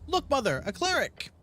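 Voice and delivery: put-on high, excited little girl pointing; young girl's voice. Little girl shows her mother the cleric, high and excited. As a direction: squeal it like a thrilled child. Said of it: "stands a young girl".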